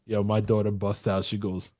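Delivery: ordinary delivery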